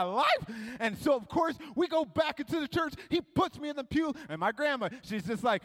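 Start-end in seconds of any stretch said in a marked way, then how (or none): none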